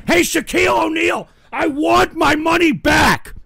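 high, loud demand, stretched out; angry shout. Real, not acted. Customer yelling at [name] over a bad chair, angry shout. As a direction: bellow a complaint at someone absent.

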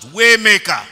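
loud shouted cry; shouted prayer. Shown as a demonstration. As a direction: call it out at full voice. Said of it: "Can you shout".

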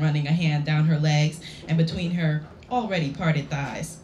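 ordinary delivery, nothing marked

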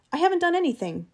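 high, distressed protest; defensive cry. A narrator's reading. Eccles denying blame to Travis, defensive cry. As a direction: insist on innocence, pitch leaping up. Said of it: "cried Eccles".

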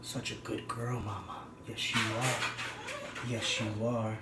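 quiet, slow cooing at the dog. Real, not acted. Baby talk to the dog.

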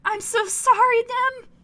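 high, loud, slowed gush of sorry; sudden apology. Real, not acted. Reader apologising to Dem, sudden apology. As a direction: blurt out an apology in a rush of feeling.

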